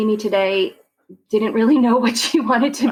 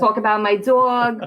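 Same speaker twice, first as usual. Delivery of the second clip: hammed-up Long Island vowels; showy Long Island accent demo. Shown as a demonstration. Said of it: "talk like this".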